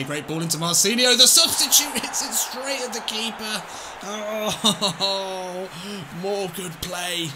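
rising excited call; excited commentary. Real, not acted. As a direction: build the chance, then laugh at the miss. Shot at the keeper.

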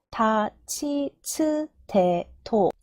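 다, 치, 츠, 대, 토 slowly, as ta, chi, tsu, te, to; syllable-by-syllable demo. Shown as a demonstration. Teacher showing the tricky ta row, syllable-by-syllable demo. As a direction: recite 다, 치, 츠, 대, 토 with each syllable held apart. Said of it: "이 타행 발음 주의해야 된다는".

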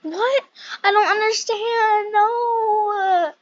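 high, loud frustrated cry; frustrated shout. Real, not acted. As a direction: cry out in disbelief. Failing the jump again.

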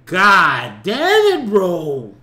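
loud, slow, fed-up curse; a slow, loud groan of frustration; exasperated outburst. Real, not acted. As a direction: drag it out, sick of the questioning. Exasperated rant at officer questions.

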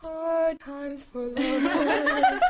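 mock melodramatic, laughing; slow, laughing lament.

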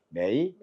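매이 with 매 dipping low then rising; third tone. Shown as a demonstration. Teacher models Chinese third tone, 매이 dipping low. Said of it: "삼성은 제일 뒤로 올 때는".

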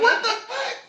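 high shocked squeal. Retold or imitated. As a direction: shriek it in disbelief. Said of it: "I'm like".

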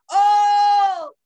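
loud, high, long-held shout; calling out. A narrator's reading. Brother O shouting for his missing brothers, long loud call. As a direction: holler one sustained vowel for someone lost. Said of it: "les gritaba".

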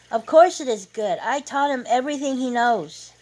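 loud, high-pitched shout across the room; boastful shout. A narrator's reading. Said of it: "a male guest with gray hair and goatee shouted".

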